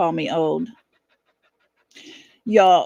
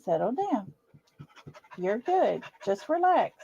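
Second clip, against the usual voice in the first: soft, hushed calming to a dog; soothing; 8 dB quieter than usual. Real, not acted. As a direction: settle her down gently and quietly. Soothing her dog softly.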